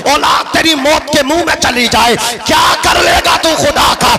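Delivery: full-force sermon with music behind; preaching. Acted. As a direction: preach it out to the crowd.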